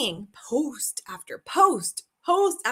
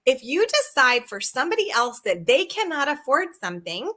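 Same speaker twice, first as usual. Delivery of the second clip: ordinary talk, nothing marked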